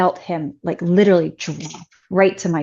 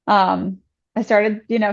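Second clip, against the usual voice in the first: normal talking voice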